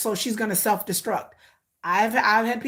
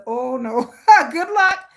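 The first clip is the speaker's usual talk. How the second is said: a laughing brush-off to her son; laughing good luck; 2 semitones higher, 6 dB louder, slower than usual. Real, not acted. Laughing at son's dream.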